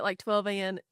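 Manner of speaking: usual speaking voice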